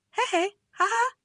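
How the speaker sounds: very high-pitched awkward chuckle; hammed-up placating giggle; put-on laugh. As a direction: fake a nervous little laugh. Placating her with forced laugh.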